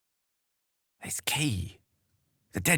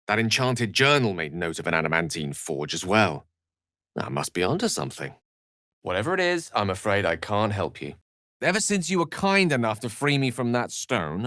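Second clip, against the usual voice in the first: normal talking voice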